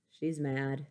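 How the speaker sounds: usual speaking voice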